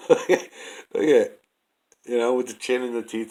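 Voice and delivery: urging the viewer to look, excitedly; excited pointing. Real, not acted. Excitedly pointing out the ape face.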